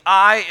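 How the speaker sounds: I punched high and loud; God's I hit with weight; stress on I. Shown as a demonstration. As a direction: lean hard on I. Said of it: "is emphatic".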